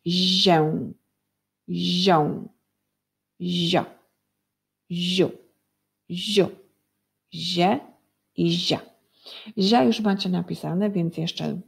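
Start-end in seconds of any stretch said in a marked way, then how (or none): 0.1-8.8 "zię, zią, zio" each drawn out singly, pauses between; syllable drill. Shown as a demonstration. Teacher joins sounds into syllables for pupils, syllable drill. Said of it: "Tworzymy sylaby, łączymy".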